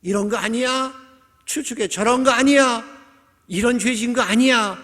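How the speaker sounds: high, loud insinuating questions; condemning accuser. Acted. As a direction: press each guess as an accusation. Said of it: "정죄하기 시작하는 거예요". (높은 목소리로 죄를 추궁하듯)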